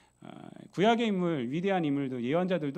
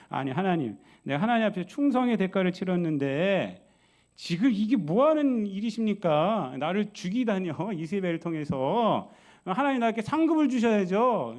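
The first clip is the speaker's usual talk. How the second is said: higher, louder mock protest; petulant pleading; 4 semitones higher, 5 dB louder than usual. Preacher voices Elijah complaining to God, petulantly pleading. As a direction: act out a demanding complaint, building to an indignant peak. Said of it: "하나님 앞에 떼쓰고 있는 거예요".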